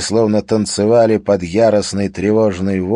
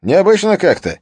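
surprised colleague; 5 semitones higher, 6 dB louder than usual. Acted. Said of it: "заметил Скарре".

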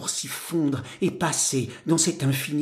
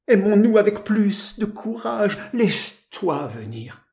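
raised, urgent lover's plea; impassioned plea; 6 semitones higher than usual. Acted. Young man begs the woman he loves to stay, impassioned plea. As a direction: plead with rising fervour, pitched high. Lover pleads against her refusal.

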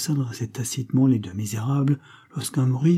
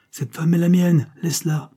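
forceful order; 7 semitones higher, 5 dB louder than usual. A narrator's reading. Husband orders bandit to release wife.

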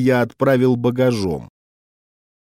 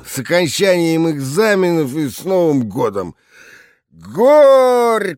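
put-on drunk toast; 5 semitones higher, slower than usual. A narrator's reading. Drunk director toasts at assembly.

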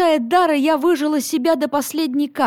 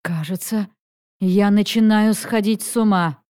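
ordinary delivery

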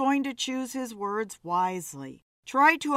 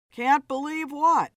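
higher, louder, irked question; a little incensed; 5 semitones higher, 5 dB louder than usual. A narrator's reading. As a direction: throw it back at her, mildly annoyed. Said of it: "Philip was a little incensed".

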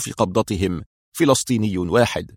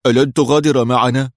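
anxious question, a little raised; concerned, louder query; worried; 2 semitones higher, 5 dB louder than usual. A narrator's reading. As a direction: ask it with mild worry. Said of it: "قال قدري في قلق".

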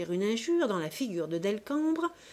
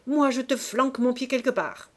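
loud, higher, taunting; mocking counter-threat; 5 semitones higher, 6 dB louder than usual. A narrator's reading. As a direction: toss the threat back with scorn.

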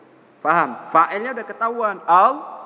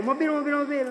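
urgent warning; 9 semitones higher, 4 dB quieter, faster than usual. Acted. Passer-by warning Zulfa of a car, acted urgent high call. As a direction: repeat the alarm pitched up high. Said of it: "Ya kan gitu".

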